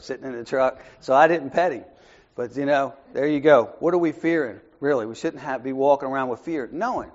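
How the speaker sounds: normal talking voice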